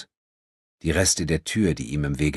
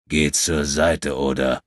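growling threat; 2 semitones lower, 3 dB louder, slower than usual. Acted. Giant threatens André again.

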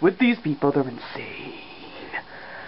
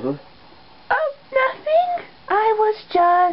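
very high, squeaky evasive voice; feigned innocence; much slower than usual. Acted. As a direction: squeak out an excuse.

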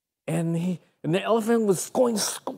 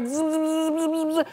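bee buzz; 8 semitones higher than usual. Retold or imitated. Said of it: "So he goes back".

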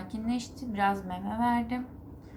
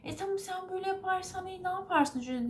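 mocking the nurse; 10 semitones higher than usual. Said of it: "Bana böyle yapıyor hemşire".

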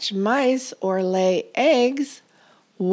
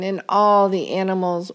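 plain reading voice

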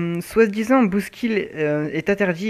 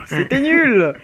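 slow words through laughter; laughing; 6 semitones higher, much slower than usual. Real, not acted. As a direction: laugh it out slowly. Laughs at exploding armor.

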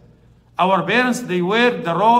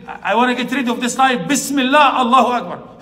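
raised, intense retelling of the son's cry; son's last words; 5 semitones higher, 6 dB louder than usual. Retold or imitated. Imam retelling the son's final cry, raised and intense. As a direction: call it out the way the son did. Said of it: "And he said".

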